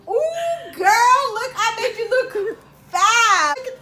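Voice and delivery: high, loud gushing; excited squeal. Acted. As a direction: show off the makeover, thrilled. Salon owner gushing over her work.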